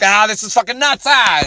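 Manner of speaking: high-pitched panicked yell, hammed up; comically terrified mock scream. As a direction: cry out like a pilot bailing at the last second. Voices pilot panicking at last second.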